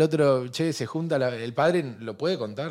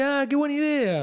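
high mock-girlish voice, much higher; mock young girl; 14 semitones higher than usual. Friend retells a girl at a club, high mock-girlish voice. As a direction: play the naive party girl up high. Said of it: "y dice".

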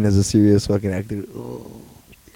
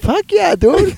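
much higher, gushing yell of approval; excited; much slower than usual. Real, not acted. Praising the Zac Efron show to his cohost, much higher and excited. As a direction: burst out with pure hype.

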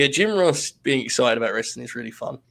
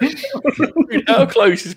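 high burst of laughter running into the words; cracking up while explaining; laughing; 11 semitones higher, 5 dB louder, much slower than usual. Real, not acted. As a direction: talk through real laughter. Cracking up at the idea.

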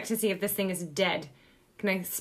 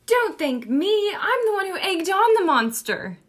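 protesting; 7 semitones higher, 4 dB louder than usual. Acted. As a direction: push the thanks away, pitched well up. Player in character refuses thanks, guilty.

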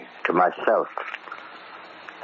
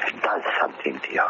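dropped to a near whisper; quiet, haunted undertone; hushed; 6 semitones lower, 4 dB quieter, much faster than usual. Acted. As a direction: let it fall away almost voiceless. Brooding prisoner confides to warden.

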